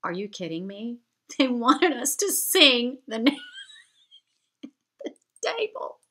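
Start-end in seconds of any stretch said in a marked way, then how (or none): 1.3-6.0 laughing through the words, barely getting them out; real giggles breaking up the sentence. Real, not acted. Laughing at the absurd request.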